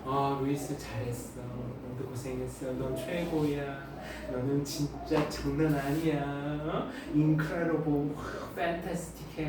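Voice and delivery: gently pat yourself on the back in your head; kind inner voice. Acted. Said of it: "늘 자기 자신에 대해서". (낮고 부드럽게 스스로를 칭찬하는 속마음 목소리)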